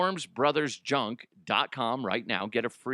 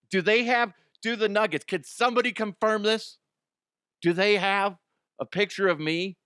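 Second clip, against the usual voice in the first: higher, louder, excited questioning; mock eager demand; 8 semitones higher, 4 dB louder than usual. Host asking if the Nuggets have his picture, mock eager. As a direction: get carried away with your own importance.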